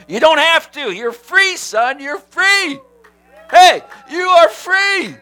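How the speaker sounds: high, loud, slowing proclamation; God proclaiming freedom. Retold or imitated. God declaring the pastor free, rising to a shout. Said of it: "he said to me".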